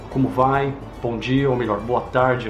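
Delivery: ordinary delivery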